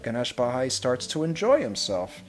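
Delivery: ordinary delivery, nothing marked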